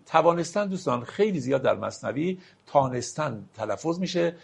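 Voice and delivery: ordinary talk, nothing marked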